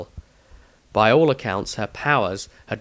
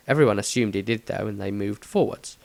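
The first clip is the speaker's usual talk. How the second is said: ordinary delivery, nothing marked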